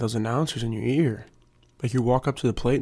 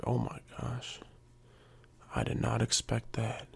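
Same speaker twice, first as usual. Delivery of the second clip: hushed, much softer disbelief; mock stunned; 10 dB quieter, slower than usual. Rival reacting to a mama insult, mock-stunned. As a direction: act floored by the burn, quietly. Acted loser of the roast.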